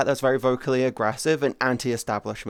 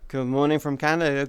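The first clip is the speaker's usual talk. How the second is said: ordinary talk, nothing marked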